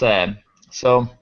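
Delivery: usual speaking voice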